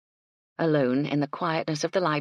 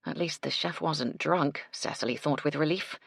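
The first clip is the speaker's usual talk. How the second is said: ordinary delivery, nothing marked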